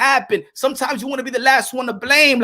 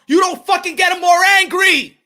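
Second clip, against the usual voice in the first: raised, heated shout at the corner people; 7 semitones higher, 4 dB louder than usual. Real, not acted. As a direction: drive it home with a loud, angry shout. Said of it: "fighter cannot fight angry".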